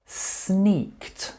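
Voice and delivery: "Sneaked" said high with the s drawn out, ssneaked; model word repeat. Shown as a demonstration. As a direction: lean on the hissing s of "Sneaked".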